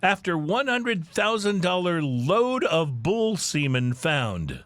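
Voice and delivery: slow, weighty news-anchor headline; dramatic headline read. Shown as a demonstration. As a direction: read it like a big breaking headline. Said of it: "The headline reads".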